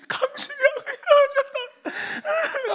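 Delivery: high, drawn-out hammed-up sobbing; imitated bawling. Son crying to his father about a breakup, hammed-up wailing. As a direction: wail the words through exaggerated tears. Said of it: "그 제가 어떻게 울었냐?".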